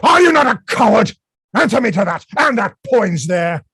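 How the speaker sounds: loud, fast angry challenge; angry accusation. Acted. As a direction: demand an answer angrily. Falstaff accusing angrily.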